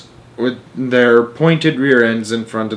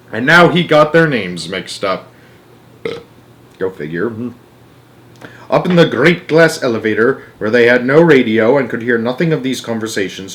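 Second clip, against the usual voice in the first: ordinary delivery, nothing marked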